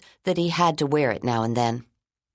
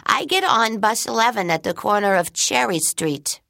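put-on little girl voice; 7 semitones higher than usual. Child voice in story.

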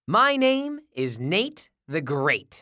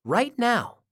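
ordinary delivery, nothing marked

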